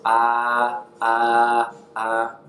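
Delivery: AH held long and level, each one separate; flat dentist tone. Shown as a demonstration. Teacher demonstrating Mandarin first tone, flat AH. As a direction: repeat AH on one unchanging pitch. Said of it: "it's flat".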